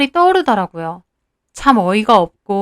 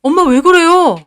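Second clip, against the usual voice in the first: higher, louder alarmed question; startled son's raised voice; startled husband; 5 semitones higher, 4 dB louder than usual. A narrator's reading. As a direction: call out to mother, taken aback. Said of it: "남편도 놀라서".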